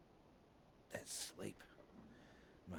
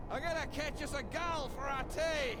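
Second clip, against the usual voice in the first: put-on high, loud, careless drunken bravado; a tipsy man shrugging off the shot; drunk cheer; 6 dB louder than usual. Drunk Floyd breezily apologising to his companion, high and loud.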